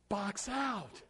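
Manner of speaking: softened, half-hearted coaching call; motherly non-yell. Shown as a demonstration. Coach showing how not to yell, mock motherly call. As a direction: give the command far too gently for a yell. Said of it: "you can't give motherly type looks when you're yelling".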